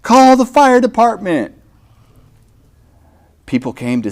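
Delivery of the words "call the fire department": high, loud urgent shout; urgent call. Acted. Storyteller calling out for the fire department. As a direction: call out for help in alarm.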